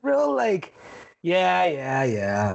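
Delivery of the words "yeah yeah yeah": brush-off, dropping very low; dismissive attitude. Retold or imitated. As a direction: wave them off with a sinking pitch. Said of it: "attitude about it".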